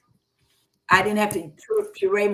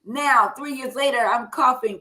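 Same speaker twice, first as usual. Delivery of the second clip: ordinary talk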